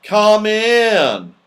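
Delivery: called out high and loud; yelled. A narrator's reading. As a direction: shout through the door. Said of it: "yelled a voice".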